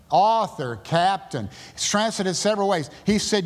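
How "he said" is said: normal talking voice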